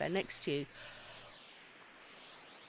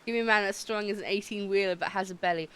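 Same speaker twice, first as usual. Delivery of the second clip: usual speaking voice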